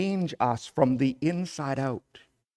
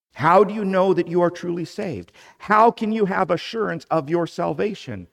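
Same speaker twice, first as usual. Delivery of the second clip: urgent preaching; 6 semitones higher, 6 dB louder, faster than usual. Real, not acted. Preacher's urgent rhetorical questions.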